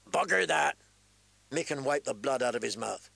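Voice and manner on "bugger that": defiant shout. Acted. Defiant smith shouts at captor.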